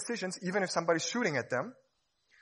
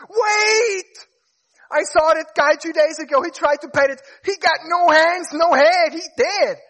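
high, loud alarmed screaming, much higher pitch; panicked shout; 14 semitones higher, 15 dB louder than usual. Acted. The amygdala screaming a warning about the tiger in panic. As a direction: shriek a frantic warning. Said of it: "The amygdala goes".

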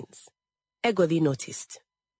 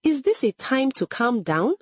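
high, indignant rising question; mildly shouted, outraged reproach; shouted retort; 7 semitones higher than usual. A narrator's reading. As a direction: challenge with pitch lifted to the end. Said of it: "Egodi shouted".

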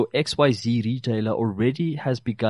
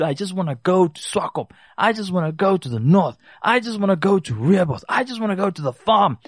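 eager holiday parent; 7 semitones higher than usual. Retold or imitated. Parents voiced wanting holidays.

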